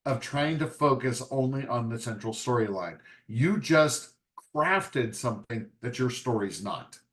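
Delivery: normal talking voice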